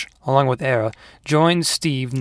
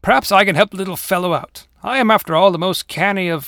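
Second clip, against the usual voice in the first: loud, higher, pompous offer; raised, self-important tiger voice; boastful paper tiger; 6 semitones higher, 5 dB louder than usual. Acted. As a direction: announce your prowess grandly.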